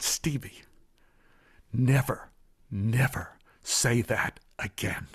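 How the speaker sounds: put-on soft and slow, crouched down to a child's level. Maid gently warning the little boy, soft and slow. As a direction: hush it, but firm. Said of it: "she got down at my level".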